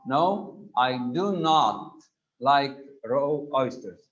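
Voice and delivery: slow, DO and NOT stressed as two separate words; emphatic do not. Shown as a demonstration. Teacher showing students why do not is emphatic here. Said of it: "because it's emphatic".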